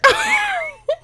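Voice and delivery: very high, drawn-out laugh; high squealing laugh. Real, not acted. Reader failing at French accent, squealing laugh. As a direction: burst into a helpless squeal of laughter.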